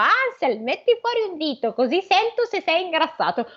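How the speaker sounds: high, calling witch voice. A narrator's reading. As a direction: call to him like the old witch through the cage.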